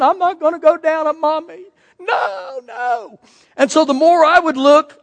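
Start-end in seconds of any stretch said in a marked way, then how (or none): 0.0-3.2 put-on crying kid. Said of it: "kids crying".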